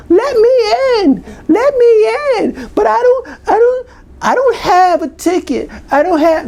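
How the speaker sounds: high, squealing plea to be let in; mock yelling. You voice locked outside the stadium, hammed-up high yelling. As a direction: wail it high like a whiny outsider. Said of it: "That you voice is outside yelling".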